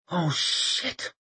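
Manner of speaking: softly muttered curse; muttering. A narrator's reading. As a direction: mutter it under the breath. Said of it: "she muttered".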